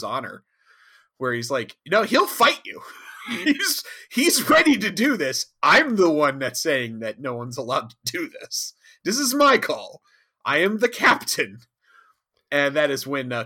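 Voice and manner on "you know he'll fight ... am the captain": higher, louder captain voice; commanding captain. Acted. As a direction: pull rank, firm and final. Janeway asserting command.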